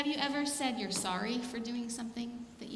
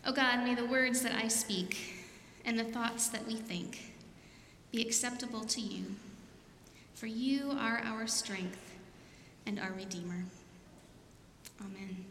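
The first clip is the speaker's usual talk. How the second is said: prayer. Acted. Opening sermon prayer.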